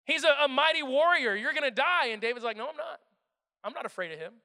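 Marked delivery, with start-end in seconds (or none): 0.0-2.1 high, loud scoffing; mocking soldiers. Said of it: "Everyone laughs at him". 2.6-4.3 defiant. Acted. David answers the doubters.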